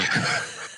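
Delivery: short burst of laughter; quick real chuckle; laughing. Real, not acted. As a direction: laugh at your own punchline. Laughs after retelling his refusal.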